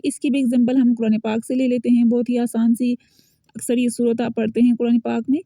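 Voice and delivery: ordinary talk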